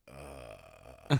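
low, soft, drawn-out dopey drone; sedated daughter. Retold or imitated. Daughter on laughing gas, imitated woozy groan. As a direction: imitate the sedative suddenly kicking in. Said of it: "all of a sudden, she's like".

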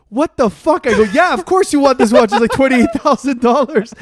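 loud, high incredulous outburst; mock outrage. Friend reacting to a twenty-eight-thousand-dollar watch pick, loud mock outrage. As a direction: explode in exasperated disbelief, half joking.

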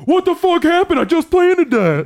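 imitating the furious enemy on the mic; mock raging player. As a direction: rant like an angry kid in game chat. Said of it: "So you hear the".

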